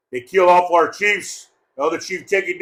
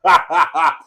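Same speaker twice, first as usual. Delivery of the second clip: loud staccato belly laugh; 3 dB louder than usual. Real, not acted. Speaker cracking up over ugly gatecrashers, laughing. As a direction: laugh in quick bursts.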